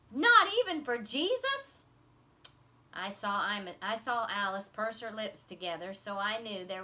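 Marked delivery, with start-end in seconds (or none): 0.1-1.9 high slow indignant question; can't believe it, pitch it way up; girl outraged there's no room for Jesus; outraged disbelief. A narrator's reading. Imogene outraged by story.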